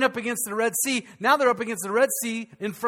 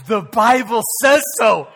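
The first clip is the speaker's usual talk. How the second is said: loud punchline; 9 dB louder, slower than usual. Real, not acted. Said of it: "punchline after joke".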